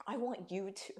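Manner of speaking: soft, tearful near-whisper; holding back tears. Real, not acted. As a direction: choke it out quietly through real tears. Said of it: "didn't mean to come on and cry".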